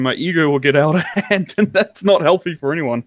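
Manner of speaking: ordinary talk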